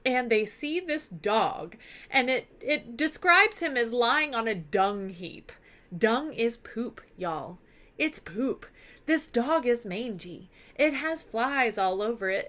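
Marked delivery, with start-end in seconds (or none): none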